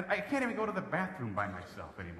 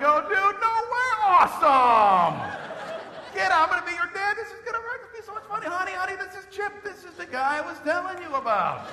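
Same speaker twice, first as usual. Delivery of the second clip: very high, hollered dude-bro elation; wild excitement; 9 dB louder than usual. Acted. Dad greets his college roommate in delivery room, ecstatic. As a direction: whoop it up like a thrilled best buddy.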